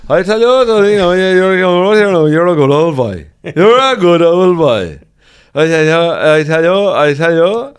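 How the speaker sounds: put-on very high, loud Irish old-boy singsong; exaggerated Irish country voice, drawn out; thick rural Irish accent. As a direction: mimic an incomprehensible Irish local at full volume. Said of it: "Even I find it hard to understand".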